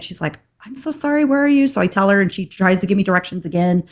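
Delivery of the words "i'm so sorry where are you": high-pitched apologetic receptionist; high voice, acted apology; apologetic phone lady. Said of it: "I call the lady".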